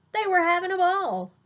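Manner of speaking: high, loud and gleeful, swept up in the fun; excited. A narrator's reading. Reading the monsters' party to a child, gleeful and loud. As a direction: let the fun burst out.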